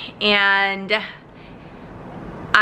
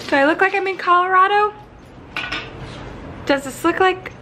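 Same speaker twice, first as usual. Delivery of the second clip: higher, mock-quizzing the viewers; playful teasing; 9 semitones higher than usual. Real, not acted. Flight attendant teasing her viewers about where she is. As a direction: tease them with the question.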